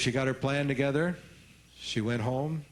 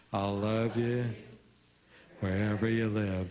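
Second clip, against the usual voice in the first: long beat after "I'll love you", then drop "wherever you live" low; pause before the punchline; 4 semitones lower than usual. Shown as a demonstration. Again for the audience, dramatic pause before the punchline. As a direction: let the silence hang, then land the line. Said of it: "Do it one more time".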